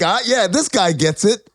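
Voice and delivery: high-pitched, approving welcome; mock delighted approval. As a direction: play the flattered joke-teller. Imagined joke-teller welcomes the laugher.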